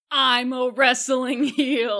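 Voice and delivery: slow, loud villain declaration; mock heel boast. Othello as a mock wrestling heel, loud and slow. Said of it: "does Othello suddenly go".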